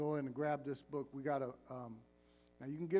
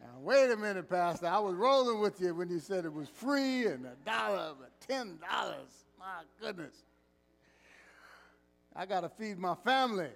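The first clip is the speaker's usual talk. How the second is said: loud, high, playful outrage; mock congregant protest; 3 semitones higher, 6 dB louder than usual. Pastor voicing a church member complaining to him, loud and high. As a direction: ham up a member haggling with the pastor. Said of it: "You're like".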